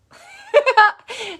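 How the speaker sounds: very loud, high shriek of laughter; big laugh. Real, not acted. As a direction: howl with laughter. Laughs at grandma flirting.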